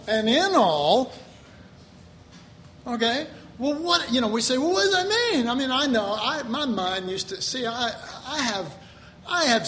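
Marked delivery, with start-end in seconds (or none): 4.6-5.5 high, whiny, puzzled voice; mock bewildered question. Preacher mimicking his confused younger self, high and whiny. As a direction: mimic a baffled listener asking.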